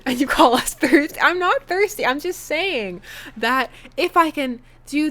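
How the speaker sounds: high, loud protest; mock indignant. Player defending herself to viewers, mock indignant.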